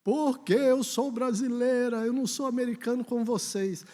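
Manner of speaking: plaintive high-pitched protest; child's retort. Retold or imitated. Daughter answering her father about being Brazilian, child's retort.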